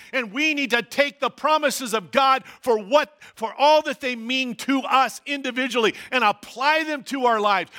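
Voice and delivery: normal talking voice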